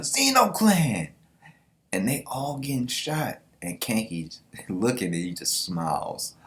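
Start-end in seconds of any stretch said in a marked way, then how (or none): 0.0-1.1 high, slow, loud shout; battle cry. Acted. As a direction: call out the clan name across the field. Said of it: "Rengyokyo's like".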